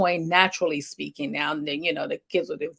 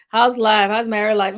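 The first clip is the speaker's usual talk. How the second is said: a louder, higher, sing-song "how's life" said in jest; playfully mocking the bored married check-in; mock cheery greeting; 4 semitones higher, 6 dB louder than usual. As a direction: toss it off as a sarcastic hello.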